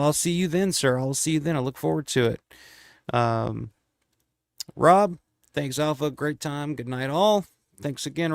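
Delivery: normal talking voice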